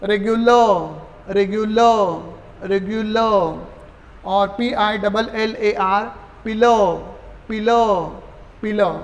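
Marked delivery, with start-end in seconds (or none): 0.0-3.7 slow teaching demo. Shown as a demonstration.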